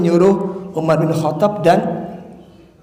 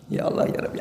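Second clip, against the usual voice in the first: whispered sigh; 11 dB quieter, much faster than usual. Acted. Exasperated aside about sleepy listeners.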